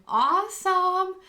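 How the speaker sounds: excited. Real, not acted. Thrilled reaction.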